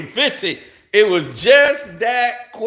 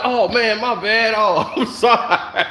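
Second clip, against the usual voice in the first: sheepish apology broken by laughter; sorry and amused at once; laughing apology; 4 semitones higher than usual. Retold or imitated. Realizing the guy wanted him on his team, laughing apology. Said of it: "I was like".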